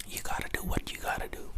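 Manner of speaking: ordinary delivery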